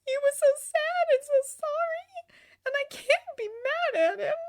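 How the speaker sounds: high tearful wail; high-pitched crying lament; whimpering. A narrator's reading. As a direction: sob out the words in a high voice. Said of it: "she whimpered".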